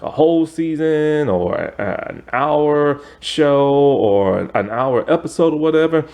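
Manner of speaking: normal talking voice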